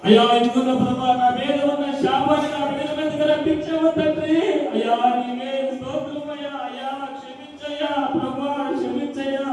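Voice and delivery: pleading prayer, lamenting to God; tearful prayer. Acted. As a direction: cry out to God for the family. Mother prays aloud to Jesus. (యేసయ్యను వేడుకునే ఏడుపు ప్రార్థన)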